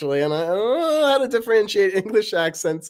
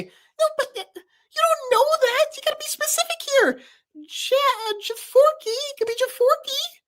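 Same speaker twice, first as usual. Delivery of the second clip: very high fussy lecturing; Paper's voice; 3 dB louder than usual. Acted. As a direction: nitpick in a squeaky voice. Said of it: "Paper and Knife talking".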